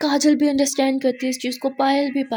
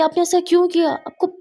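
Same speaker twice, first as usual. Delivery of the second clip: angry protest; 6 semitones higher than usual. Acted. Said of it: "मुस्कान गुस्सा करने लगती है".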